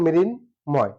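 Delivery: মৃন, short pause, ময়, keeping the m sound; "mrin... moy" in two separate syllables; correct pronunciation. Shown as a demonstration. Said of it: "সঠিক উচ্চারণ". (সঠিক উচ্চারণে ভেঙে "মৃন্... ময়্")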